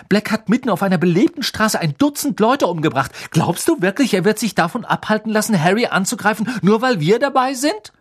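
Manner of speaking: high, loud, indignant argument; building to an incredulous question; heated scolding. A narrator's reading. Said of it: "fuhr ihn Hermine an".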